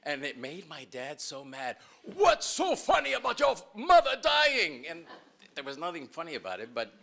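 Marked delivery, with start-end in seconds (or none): 2.2-4.8 loud high furious yell from a father; angry dad shout. Retold or imitated. Dad angry at laughing kids.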